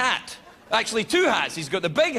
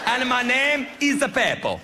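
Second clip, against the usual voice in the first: higher-pitched mock Italian accent; the Pope; 4 semitones higher than usual. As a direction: introduce yourself in a cartoon Italian accent. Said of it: "he's not even Italian anymore".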